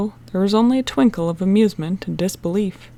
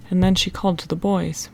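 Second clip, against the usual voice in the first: ordinary delivery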